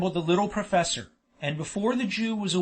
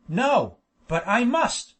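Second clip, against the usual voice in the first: insistent, louder and climbing in pitch; persistent; 4 semitones higher, 4 dB louder, slower than usual. A narrator's reading. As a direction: refuse to give in, pushing the words. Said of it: "persisted the professor".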